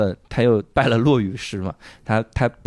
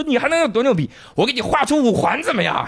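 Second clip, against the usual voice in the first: high, cocky bragging; boastful hype; 10 semitones higher than usual. Retold or imitated. Pilot bragging to the committee, high cocky hype. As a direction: puff up the promise.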